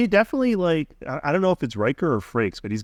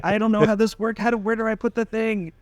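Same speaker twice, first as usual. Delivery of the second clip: put-on high, thin, fumbling old-man voice; 9 semitones higher than usual. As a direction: play a confused old man, pitched way up. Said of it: "And he's like".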